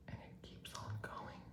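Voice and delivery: whispered repeat of the same line. Shown as a demonstration. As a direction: drop to a soft whisper.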